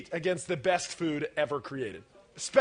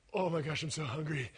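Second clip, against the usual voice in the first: low, soft acted craving; acted hunger; 6 semitones lower, 10 dB quieter than usual. Speaker acting someone waiting for pizza, quiet craving. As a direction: murmur it as if weak with hunger. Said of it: "You're just like".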